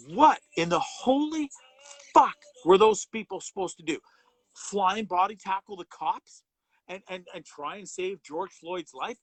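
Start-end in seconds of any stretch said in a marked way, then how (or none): 0.1-6.4 loud, high exasperated outburst, drawn out at first; furious rebuttal, voice jumping up and loud; real outrage. Real, not acted. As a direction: explode in disbelief, punching the swear word.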